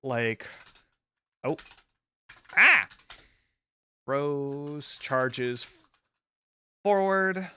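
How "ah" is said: startled yelp. Real, not acted. Sudden startled exclamation.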